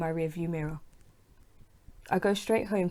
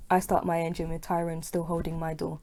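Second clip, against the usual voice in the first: ordinary delivery, nothing marked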